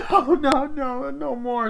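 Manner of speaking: very high, loud desperate pleas; overplayed terrified no; panicked begging. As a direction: beg for it to stop. Victim begging to stop.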